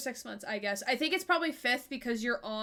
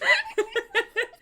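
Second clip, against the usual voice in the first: a sudden squeal of a laugh; high burst of laughter; 12 semitones higher, 5 dB louder than usual. Real, not acted. Laughing at silly rhyming words.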